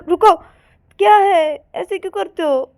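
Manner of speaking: high, loud objection; exasperated protest. Acted. Payal grabs Ananya's hand to stop her, exasperated protest. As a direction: burst out to stop someone.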